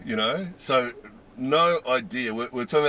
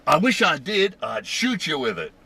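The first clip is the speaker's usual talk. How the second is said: high, loud mock threat; imitated angry screaming; 10 semitones higher, 5 dB louder than usual. Angry shopper turning on her heckler, imitated yelling. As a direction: mimic her enraged comeback, easing off at the end. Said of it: "someone screaming in English".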